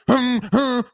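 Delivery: loud, high hummed buzzes; imitated phone buzz. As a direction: hum like a vibrating phone, in bursts. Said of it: "my phone keeps buzzing".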